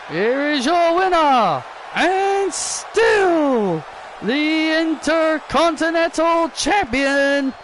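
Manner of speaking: drawn-out, high winner announcement; ring announcer. Acted. Announcer naming the champion, booming. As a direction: stretch the name for the arena.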